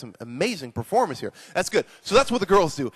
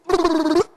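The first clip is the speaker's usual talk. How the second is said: high lip-trill burbles; lip-flapping demo; 11 semitones higher, 4 dB louder than usual. Shown as a demonstration. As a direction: flap the lips noisily. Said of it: "his lips have gotta go".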